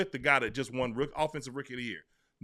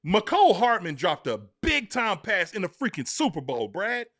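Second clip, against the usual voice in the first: ordinary delivery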